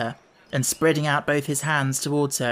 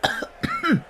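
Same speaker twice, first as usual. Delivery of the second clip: short high choking cough. A narrator's reading. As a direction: cough on a mouthful of bread. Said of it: "in a choking voice".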